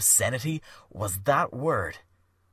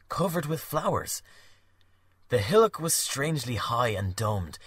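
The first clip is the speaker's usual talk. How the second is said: usual speaking voice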